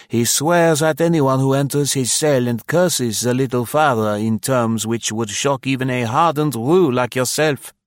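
governor's voice. A narrator's reading. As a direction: read the governor as a stiff, complaining official. Governor's voice in read story.

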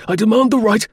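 high, loud protest; desperate shout. Acted. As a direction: shout it out to be heard. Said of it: "shouted Martin in English".

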